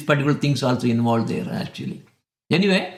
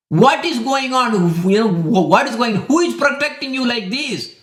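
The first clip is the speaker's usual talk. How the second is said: raised, indignant questioning; demanding angry king; 7 semitones higher than usual. Retold or imitated. Angry king demands to know who protects his son, pitched high.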